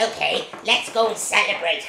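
low witch reply; scheming witch. Acted. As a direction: agree with a sly grin. Other witch answers, low.

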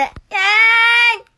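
very high, loud, stretched yay; hyped cheer. Real, not acted. Answering chat's praise of her tension with a stretched cheer. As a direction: belt it like a hype idol.

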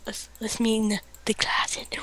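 creepy whisper. Pretend. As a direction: breathe it close and creepy.

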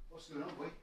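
hushed coaxing; quiet coaxing call; soft aside to dog. Real, not acted. Calling dog to food.

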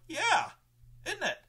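very high, smug yeah isn't it; gleeful. Retold or imitated. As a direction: tease them with delight. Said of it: "And I'm like".

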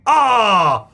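startled yelp as the bats burst out of the cave; startled yell. Real, not acted. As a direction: jump at the bats flying out.

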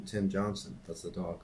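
ordinary delivery

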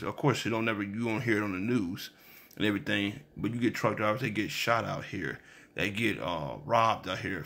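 ordinary talk

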